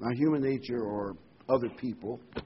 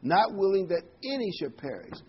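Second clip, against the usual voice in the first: "any" punched in a higher-pitched line; emphatic stress on any; 8 semitones higher, 4 dB louder than usual. Real, not acted. Preacher driving home God's will, stressing "any". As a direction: repeat the verse with force on "any". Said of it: "I want to emphasize that word".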